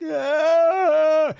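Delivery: high, loud strained yell for help; mock yell. Stranded climber yelling to his buddy, loud mock yell. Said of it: "he's trying to yell".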